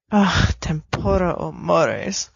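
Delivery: ordinary delivery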